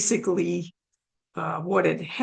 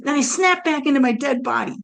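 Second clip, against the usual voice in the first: ordinary talk, nothing marked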